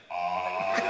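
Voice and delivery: loud, low, clumsy "ah"; guys' failed attempt. Shown as a demonstration. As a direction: botch the cooing sound with a deep bellow. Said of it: "this is what it sounds like".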